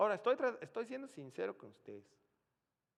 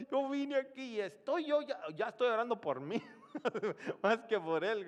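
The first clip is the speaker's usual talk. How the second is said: laughing aside; 8 semitones higher, 8 dB louder than usual. Real, not acted. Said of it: "¿no?".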